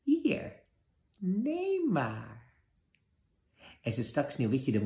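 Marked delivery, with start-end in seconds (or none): 0.0-2.4 put-on much higher, slow quavering old crone voice; trembling old woman. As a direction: quaver like a frail old woman hiding a wicked queen. Old woman offers the apple.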